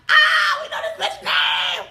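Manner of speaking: loud, laughing shriek; mock scream. Reacting as he yells out her name, laughing scream. Acted gleeful yell at hearing name.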